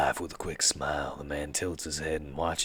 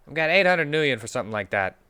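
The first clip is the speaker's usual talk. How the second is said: buyer voice; 11 semitones higher, 10 dB louder, faster than usual. Acted. NPC buyer offer.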